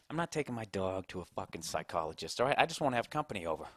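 normal talking voice

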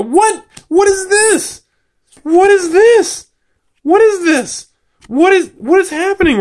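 high-pitched, repeated cries of disbelief; stunned disbelief. Real, not acted. Opener can't believe the box, high incredulous wail.

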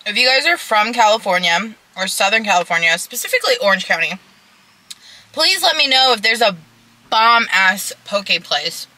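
speaking between bites; talking while chewing. Real, not acted. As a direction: mouth partly full of food. Seen 2: Holds poke bowl, cheeks full, mouth working food while talking.

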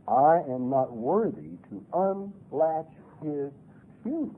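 slow, deliberate quoting; humble quote. Acted. Preacher repeating John's words to the congregation, slow and humble. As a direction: lay each word of the quote down slowly.

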